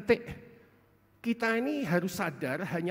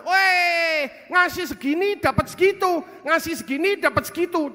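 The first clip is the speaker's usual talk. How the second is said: higher, loud, bargaining greedily; mock haggler; 9 semitones higher, 10 dB louder than usual. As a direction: haggle with God like a stallholder. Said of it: "Dia hitung-hitungan sama Tuhan".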